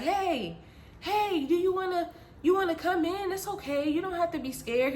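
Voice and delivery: sing-song mock reassurance; coaxing stranger. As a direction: lure them in with a soft, high call. Voices stranger coaxing from truck.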